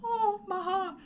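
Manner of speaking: high, moved little cry of affection; as if melting at a sweet message; a hint of tearful tenderness; touched. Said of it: "I was like".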